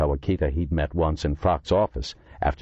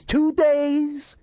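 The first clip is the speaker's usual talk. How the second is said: much higher indignant cry; he can't believe it, two days!; outraged exclamation; 4 dB louder than usual. A narrator's reading. Outraged repeat of the grievance.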